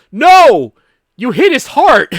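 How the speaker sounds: high, loud mock-horrified cry; mock outcry. Mira protesting as Eric is shot, mock outcry. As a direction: cry out like an overdramatic game character. Acting out game character's outburst.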